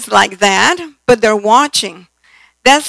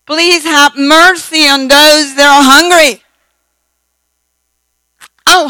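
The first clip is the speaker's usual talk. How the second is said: raised, pompous praying tone; mock long prayer; 5 semitones higher, 6 dB louder than usual. Mocking a long-winded grace before the meal, pompous prayer voice. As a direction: ham up a droning preacher at dinner.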